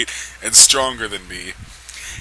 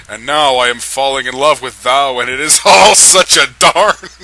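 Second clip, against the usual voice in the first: fighting back a laugh; laughing through; 2 semitones higher, 7 dB louder than usual. Real, not acted. Narrator losing it reading Edward's love line. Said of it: "I'm trying not to laugh".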